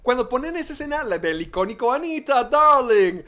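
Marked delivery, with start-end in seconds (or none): 1.9-3.2 mildly theatrical quote, raised and louder; lightly hammed-up movie catchphrase; imitating an iconic film line. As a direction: put a little showy lift on the quote.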